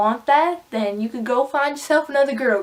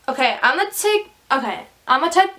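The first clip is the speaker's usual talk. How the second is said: fast, higher-pitched, stumbling start; nervous, speaking fast; 5 semitones higher, faster than usual. Real, not acted. Vlogger flustered while describing herself, nervous and rushed. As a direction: rush it and trip over the words. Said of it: "I'm, like, nervous and I'm, like, speaking fast".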